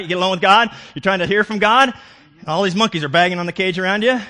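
loud, high, driving sermon climax; preaching at full force. Acted. Preacher urging his congregation to seek God, full-voiced. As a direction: preach it with rising fervor.

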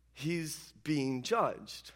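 drawn out very slowly and softly; very slow, quiet emphasis. Real, not acted. Preacher stressing Jesus on trial, slow and hushed. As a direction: let each word sink, hushed.

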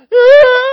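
sudden high shriek of burning pain; loud pained cry. Retold or imitated. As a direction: yelp as the pepper burns. Biting jalapeno, burning mouth.

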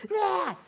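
short high, loud yell; chaotic yell. Acted. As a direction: yell out like everything is falling apart. Imitating chaos with a yell.

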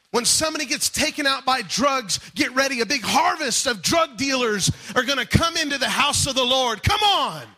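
high, forceful pulpit proclamation; full-force preaching. Acted. Preacher rousing the church over a coming harvest, full-force preaching. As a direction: build to a shouted rallying call.

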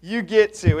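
loud, slow fatherly correction; dad's stock reply. Retold or imitated. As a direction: lay it down like a stern dad. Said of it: "Somebody else heard my dad speak".

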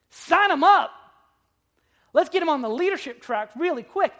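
hammed-up eager disciples grabbing a recruit; mock eager recruiting.

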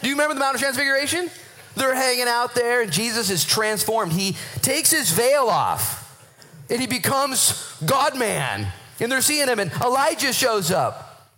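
high-pitched, excited Bible storytelling; breathless-sounding, rousing sermon narration; fervent preaching. Real, not acted. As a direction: build wonder at full stretch, pitch held high. Excited retelling of the Transfiguration.

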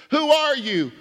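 high, loud "Who are you?" with "are" punched; pressing question. Shown as a demonstration. Preacher repeatedly pressing the congregation: who are you. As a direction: demand it with "are" stressed. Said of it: "If I were to say".

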